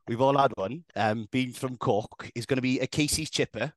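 put-on Valleys Welsh; amateur Welsh impression; Welsh accent. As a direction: read it out in mock Welsh. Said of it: "don't speak in Welsh".

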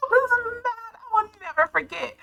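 high, laughing through it. Real, not acted. Vlogger laughing about being mad at God. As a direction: laugh while confessing. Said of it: "I'm laughing at it".

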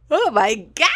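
sudden loud "oh my God"; surprised shout. Real, not acted. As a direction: blurt it out in shock.

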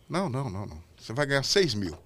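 hushed-down, confidential retelling of his words; Silvio in private. Retold or imitated. Silvio praises the helper in the dressing room, soft imitated voice. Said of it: "me chamou no camarim e falou".

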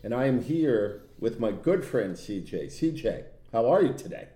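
normal talking voice

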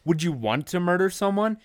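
high, loud mock questioning; dramatic interrogation. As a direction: grill him like a prosecutor. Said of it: "I said".

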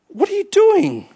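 high, slow incredulous question; psalmist scolding his soul. Acted. Psalmist challenging his own soul, high and incredulous. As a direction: ask it as if catching yourself out. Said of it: "he's saying".

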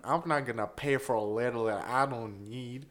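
put-on American accent; stingy American. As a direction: play a penny-pinching American. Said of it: "because Americans are stingy capitalists".

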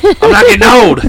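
loud, high-pitched indignant denial; loud protest. Real, not acted. Host refusing to accept getting old, loud protest. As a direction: shout it down in protest. Repeats the denial, much louder.

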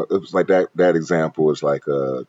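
ordinary talk, nothing marked

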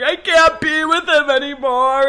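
high, loud, breaking into tears; retelling the crying words to her friend; tearful confession. Retold or imitated. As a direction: blurt it out through tears. Tearful quote to best friend.

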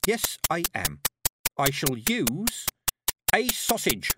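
defiantly grand reveal; proud declaration. A narrator's reading. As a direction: unveil it slowly to the crowd. Petulia announces her trick to crowd.

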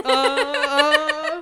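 high, loud "uh" wails; mock panicked wail. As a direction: wail in exaggerated panic, retelling it. Panicking, running up and down.

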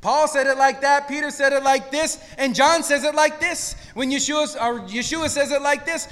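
normal talking voice

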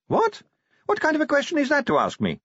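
very high, hurried indignant question; shocked. A narrator's reading. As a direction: shoot the pitch up in shock. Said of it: "She looked shocked".

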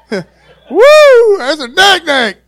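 high, very loud whoop breaking from a laugh; hammed-up shout at a monster sighting; whooping alarm. Preacher playing Rick spotting a mammoth snake, whooping alarm. Acting out seeing huge snake.

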